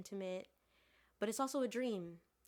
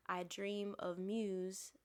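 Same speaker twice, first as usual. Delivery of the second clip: usual speaking voice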